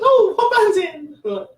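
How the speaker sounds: put-on very high, slow old-lady voice; little old dear. Little old lady asking the metalheads about the band, high old-dear voice. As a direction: draw it out as a curious old dear. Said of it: "and she goes".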